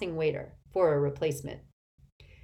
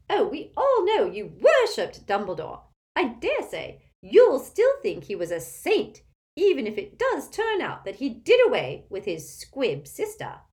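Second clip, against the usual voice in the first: loud, domineering Auntie Muriel character voice; imperious tipsy old aunt; 8 semitones higher, 7 dB louder than usual. A narrator's reading. Aunt greeting Harry at the table, imperious and loud. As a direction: project like a tactless old relative.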